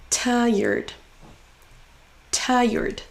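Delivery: TI in "Tired" held long; stressed syllable drawn out. Shown as a demonstration. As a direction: stretch the first sound of "Tired", then fall. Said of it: "en faisant traîner un". (début de « Tired » traîné)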